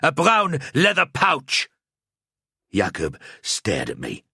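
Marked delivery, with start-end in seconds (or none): 0.0-1.7 put-on slow, higher, gruff Bretonnian-accented accuser. A narrator's reading. Grubheimer accusing the priest in a strong Bretonnian accent. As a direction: point him out in a heavy Bretonnian accent. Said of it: "his voice gruff, his accent strong".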